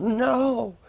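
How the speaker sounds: high, drawn-out mock yell; retold yell. Mother suddenly yelling in her son's face, retold yell. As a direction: cry it out high, not at full volume. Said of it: "and she just yelled".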